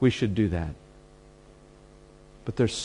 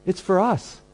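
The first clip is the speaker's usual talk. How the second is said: defensive churchgoer; 9 semitones higher than usual. Retold or imitated.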